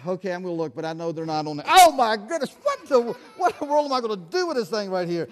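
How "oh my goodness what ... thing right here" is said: louder, higher oh my goodness; hammed-up dismay; mock exasperation. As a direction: pretend you're at your wits' end. Spots again, feigned exasperation.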